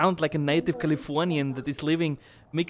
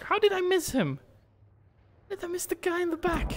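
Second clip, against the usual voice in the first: high-pitched, incredulous outburst; exasperated disbelief. Real, not acted. Gamer to himself after missing an enemy, high incredulous dismay. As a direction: question your own miss in high disbelief. Player frustrated at missing a target.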